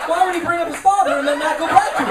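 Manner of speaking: high, loud, worried demanding; mock concerned audience member. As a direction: demand closure like a fretful fan. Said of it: "You were, like, concerned".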